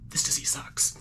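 whispered aside. Real, not acted. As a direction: whisper it to yourself. Sick, muttering aside.

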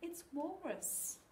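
put-on high, slow childish protest; grumbling. A narrator's reading. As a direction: stretch it out like a kid blaming someone else. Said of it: "grumbled Flynn".

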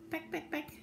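high, soft little pecks; pecking rooster. Acted. Rooster tickling the sleeping cow, high soft pecking. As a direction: peck lightly in a high, small voice.

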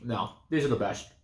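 talking between bites; chewing while talking. Real, not acted. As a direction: mouth partly full. Seen 3: Hand brings candy to mouth through 1.3s; later talks, bag held.

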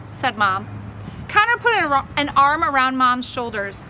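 ordinary delivery

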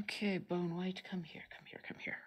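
soft coaxing whisper. Real, not acted. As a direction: whisper to the paint to come. Coaxing paint onto brush.

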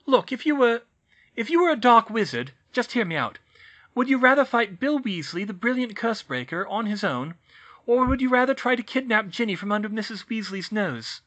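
high, loud, pressing argument; urgent explaining. A narrator's reading. Hermione presses Harry to grasp the dragon danger, high and loud. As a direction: make him see the danger.

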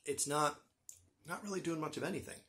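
normal talking voice

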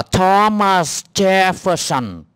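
"Thomas Jefferson" drawn out syllable by syllable; slow, clear demonstration. Shown as a demonstration. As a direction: slowly spell out "Thomas Jefferson" by ear. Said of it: "nó đọc chậm cho nghe".

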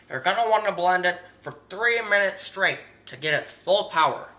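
ordinary talk, nothing marked

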